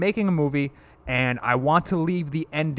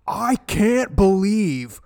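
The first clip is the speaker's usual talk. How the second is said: very slow, higher, awestruck stoner drawl; Keanu Reeves impression; 5 semitones higher, 5 dB louder, much slower than usual. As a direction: stretch every word like a dazed surfer dude. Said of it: "this is my impersonation of Keanu Reeves".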